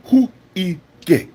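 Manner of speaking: mock African accent, emphatic and halting; hammed-up African accent, word by word; Chaba. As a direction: put on a punchy African accent. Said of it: "Chaba like".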